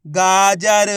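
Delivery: गाजर loud and stretched out; drawn-out model of गाजर; blended word. Shown as a demonstration. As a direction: read गाजर holding each syllable. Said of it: "तो बताइए मिलके क्या बनेगा".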